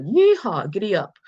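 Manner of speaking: put-on high, loud whooping cowboy accent. Teacher mocking accents in America, hammed-up cowboy accent. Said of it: "cowboy accent".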